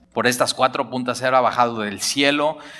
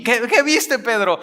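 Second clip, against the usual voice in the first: much higher and louder, bursting to know; eager mock question; 9 semitones higher, 3 dB louder than usual. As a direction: squeal out what did you see. Eager listener demanding the vision. (oyente ansioso que exige saber, voz mucho más aguda y fuerte)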